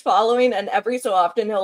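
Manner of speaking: usual speaking voice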